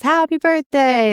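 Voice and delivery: high, drawn-out happy greeting; mock celebratory call, slow and high; birthday cheer. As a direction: stretch it out high, imitating a birthday well-wisher. Acting out his midnight birthday greeting.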